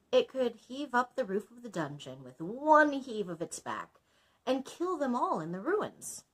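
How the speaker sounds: plain reading voice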